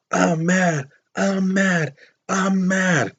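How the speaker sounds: high-pitched held-back yell, drawn out; mock shouting. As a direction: imitate the stabber's cries without real volume. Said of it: "Powell was shouting".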